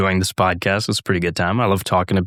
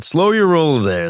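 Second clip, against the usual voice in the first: teasing; 8 semitones higher, 4 dB louder, slower than usual. Pretend. Teasing the audience.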